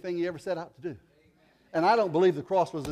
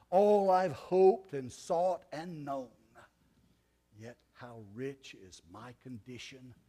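slow recited hymn; 3 semitones lower, slower than usual. Acted.

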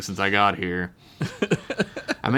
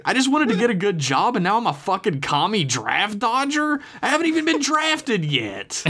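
very high-pitched indignation; outraged disbelief, voice shooting up; incredulous protest; 12 semitones higher than usual. A narrator's reading. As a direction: protest as if wrongly accused. Stunned protest in acted phone quarrel.

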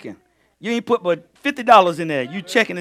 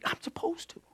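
whispered aside; 11 semitones higher, 13 dB quieter than usual. Real, not acted. Whispered aside after quote.

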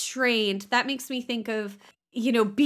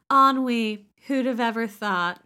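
self-satisfied, theatrical gentleman; smug aristocrat; 5 dB louder than usual. Retold or imitated. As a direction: preen like a bored dandy. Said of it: "And he says, like".

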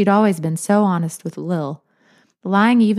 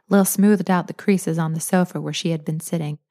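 usual speaking voice

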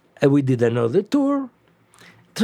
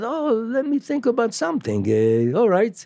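musing Doug Yeager; 9 semitones higher than usual. Retold or imitated. Manager Doug Yeager pondering.

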